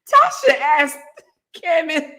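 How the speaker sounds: high, tearful laughing attempts to speak; real laughing fit. Real, not acted. Host retelling Kevin's answer, high and breaking with laughter. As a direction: struggle to get the sentence out through laughter. Cannot finish sentence for laughing.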